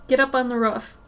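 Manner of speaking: "roof" with the short vowel of "book". Shown as a demonstration. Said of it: "I say roofs".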